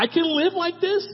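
sudden high burst; excited realization. Acted. Believer realizing new life, excited high burst.

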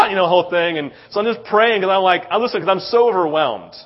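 ordinary talk, nothing marked

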